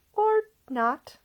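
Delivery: high, dry little aside; wry young lady. A narrator's reading. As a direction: toss it off wryly, high.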